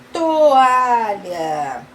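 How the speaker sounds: long, stretched syllables. Shown as a demonstration. Teacher confirms the word for children, syllable by syllable. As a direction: draw the word right out.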